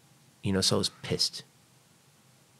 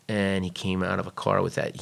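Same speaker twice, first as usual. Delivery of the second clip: usual speaking voice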